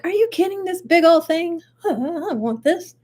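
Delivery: playfully incredulous, voice lifted; mock incredulity. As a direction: react to a gift that seems like a joke. Mock reaction to a gift.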